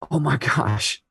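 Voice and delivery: quietly choking up mid-sentence; choked up. Real, not acted. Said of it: "I'm getting choked up".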